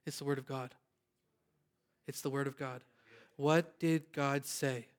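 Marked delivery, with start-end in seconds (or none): 0.1-2.8 hushed. Real, not acted. Quiet, solemn repeated answer.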